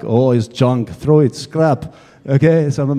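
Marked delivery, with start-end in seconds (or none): 0.0-1.9 slow, dismissive mock Russian accent; Russian-accented verdict, drawn out; Russian-accented mechanic. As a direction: imitate a gruff Russian mechanic writing it off. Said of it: "He's got a big Russian voice".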